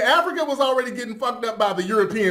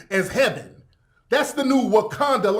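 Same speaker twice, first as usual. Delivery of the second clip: normal talking voice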